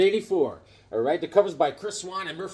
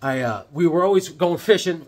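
ordinary talk, nothing marked

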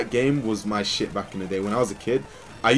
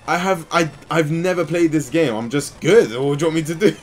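exasperated retort; 6 semitones higher, 7 dB louder than usual. Retold or imitated.

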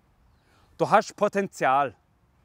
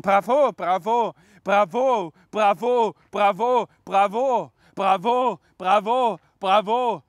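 higher-pitched repeated cheer; cheering bravos; 4 semitones higher, 5 dB louder, slower than usual. Acted. Acting the poem's applauding audience, repeated cheering. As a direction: acclaim the singer over and over.

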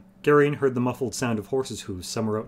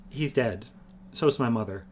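plain reading voice

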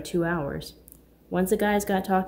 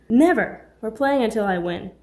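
defiant shout; 4 semitones higher, 7 dB louder than usual. Acted. Pool rivalry banter.

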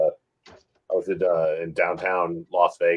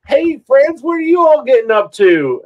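much higher, louder odd stranger greeting; weird stranger voice; 10 dB louder than usual. Retold or imitated. Stranger approaching friends in Las Vegas, weird high loud voice. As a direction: put on a strange overeager voice. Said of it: "this guy with the weirdest voice".

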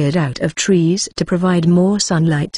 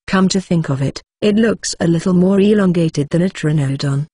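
ordinary delivery

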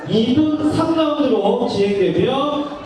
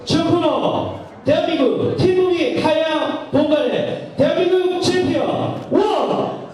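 fight announcer intro; 4 semitones higher than usual. Acted. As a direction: hype up the fighter's name. Fighter introduction, corner call.

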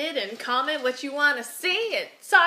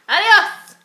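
loud goodbye; 8 semitones higher, 12 dB louder than usual. Real, not acted. Loud sign-off.

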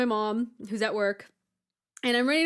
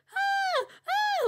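very high mock wail; imitated crying scream; 3 dB louder than usual. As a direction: imitate a sister's screaming sobs. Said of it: "she is screaming and she is crying".

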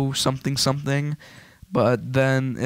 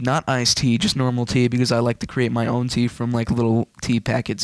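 ordinary talk

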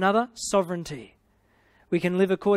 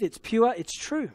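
ordinary talk, nothing marked

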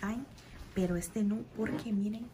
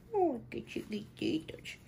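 delighted squeal; 6 semitones higher than usual. Real, not acted. Cooing over tiny clothes.

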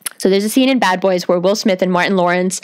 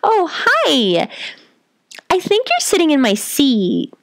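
high, put-on friendly greeting; exaggerated non-threatening sweetness, high-pitched; high careful voice; 8 semitones higher, slower than usual. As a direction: pitch it way up to sound harmless.

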